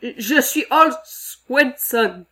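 frightened foreman facing the monster; trembling voice. Acted. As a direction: try to sound brave but tremble. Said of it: "mais sa voix tremblait".

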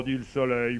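usual speaking voice

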